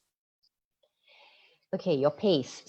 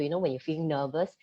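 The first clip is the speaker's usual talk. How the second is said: ordinary talk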